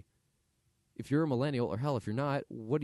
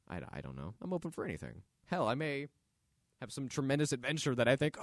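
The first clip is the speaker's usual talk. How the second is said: ordinary talk, nothing marked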